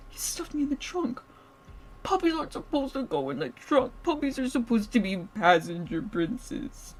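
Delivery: put-on high, babyish indignant whine; pouty puppy whine. Captive puppy protesting being put in the trunk, babyish whine. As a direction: complain like a spoiled puppy.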